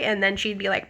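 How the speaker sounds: ordinary talk, nothing marked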